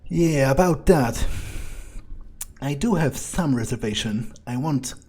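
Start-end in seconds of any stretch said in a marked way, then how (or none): none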